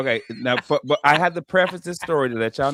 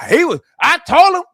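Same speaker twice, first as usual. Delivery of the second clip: high, loud mock mama voice; a proud mother bragging in a put-on voice; mimicking mama; 11 semitones higher, 6 dB louder than usual. Comic imitating his mama bragging at the Comedy Zone, high mock voice.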